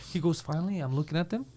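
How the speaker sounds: ordinary delivery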